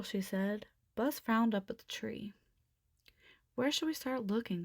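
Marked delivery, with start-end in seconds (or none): none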